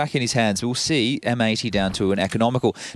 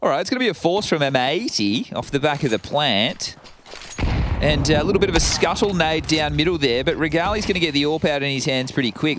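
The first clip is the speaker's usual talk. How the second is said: ordinary talk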